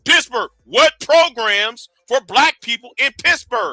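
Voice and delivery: drags every word out slowly to drive it home; slowly, pointedly, each word laid down; slow emphatic question. Real, not acted. Host pressing his debate opponent, slow and deliberate. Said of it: "I'm emphasizing the word".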